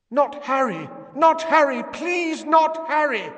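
high, loud pleading scream; desperate screaming. A narrator's reading. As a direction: beg for her child's life. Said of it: "Someone was screaming".

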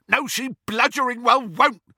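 high, loud contradiction; heated roar; angry bellow. A narrator's reading. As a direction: bellow it back furiously. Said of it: "bellowed Amycus".

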